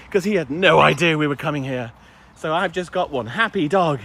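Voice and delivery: ordinary talk, nothing marked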